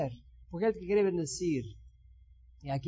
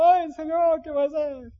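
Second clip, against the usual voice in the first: higher, louder hammed-up "Ay, Señor"; dramatic wail; 7 semitones higher, 3 dB louder than usual. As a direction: cry it out theatrically to the Lord. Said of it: "Qué dramático". (lamento exagerado, agudo y fuerte)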